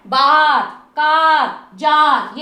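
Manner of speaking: बार, कार, जार each loud with a long "aa"; long sound. Shown as a demonstration. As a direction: stretch the vowel in बार, कार and जार, one by one. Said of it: "long sound आ रहा है". (बार, कार, जार ज़ोर से, लंबे "आ" के साथ)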